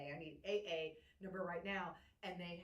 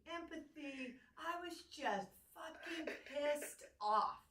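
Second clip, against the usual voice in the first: usual speaking voice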